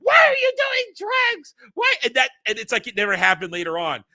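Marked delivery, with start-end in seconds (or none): 0.0-1.9 very high, loud shriek; hammed-up hysterical scolding shout; mock yelling. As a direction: imitate a frantic crowd screaming. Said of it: "everybody's yelling at him".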